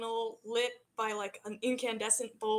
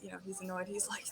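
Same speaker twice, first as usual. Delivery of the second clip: normal talking voice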